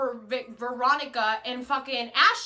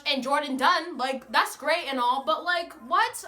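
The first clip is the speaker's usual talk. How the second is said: ordinary talk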